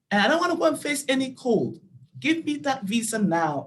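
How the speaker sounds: high, loud, insistent demands; demanding rant. Retold or imitated. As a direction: tell the visa officer off, high and loud. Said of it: "I looked at the woman straight in her face and I said".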